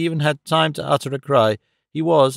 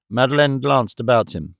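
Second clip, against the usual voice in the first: ordinary delivery, nothing marked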